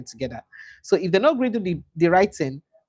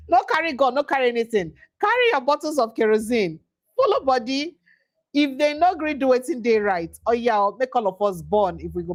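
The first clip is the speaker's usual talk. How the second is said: pitched high, in disbelief; high, incredulous pidgin paraphrase; aghast retelling; 8 semitones higher than usual. Real, not acted. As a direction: repeat the threat like you can't believe it.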